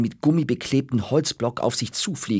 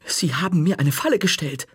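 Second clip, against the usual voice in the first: higher, startled protest; surprised; 6 semitones higher than usual. Acted. The caught boy to the librarian, startled and higher. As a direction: caught off guard, blurting it out. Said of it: "sagte Marty überrascht".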